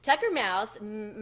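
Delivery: ordinary delivery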